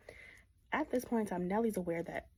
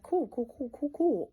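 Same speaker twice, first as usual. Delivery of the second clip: high, louder rush of cool cool; eager; 7 semitones higher, 7 dB louder than usual. Retold or imitated. Kathy agreeing to Edgar's visit, eager and high. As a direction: gush with glee.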